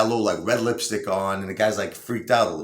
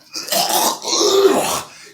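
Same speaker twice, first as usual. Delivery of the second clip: disgusted throat clearing; 7 dB louder than usual. Acted. Disgusted by her breath.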